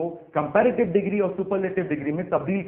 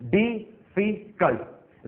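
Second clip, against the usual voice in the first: Difficult said very slowly in three syllables di-fi-kalt, a bit higher; 5 semitones higher, much slower than usual. Shown as a demonstration. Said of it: "तीन आवाजें निकलती हैं".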